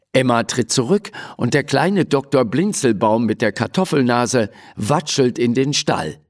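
usual speaking voice

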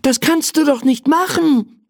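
put-on high, excited child voice; aufgeregter Junge. A narrator's reading. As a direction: call out in a boy's upset protest. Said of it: "rief er aufgeregt". (aufgeregte, hohe Kinderstimme)

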